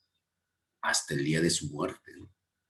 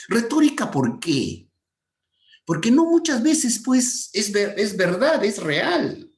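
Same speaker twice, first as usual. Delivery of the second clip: emphatic; 6 dB louder, slower than usual. Real, not acted. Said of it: "teacher stresses point emphatically".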